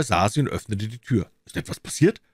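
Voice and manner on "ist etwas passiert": concerned. A narrator's reading. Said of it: "fragte er betroffen".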